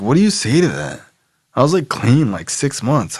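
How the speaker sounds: ordinary talk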